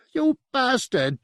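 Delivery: a demon's high, slow curse with an Australian lilt. A narrator's reading. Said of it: "Its accent had an Australian lilt".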